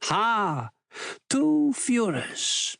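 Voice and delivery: a short chuckle, then a high, slow "Two Führers"; amused chuckle, stretching the words high and slow; chuckling. Acted. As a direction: laugh it off, amused and drawn out. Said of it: "Goering chuckles".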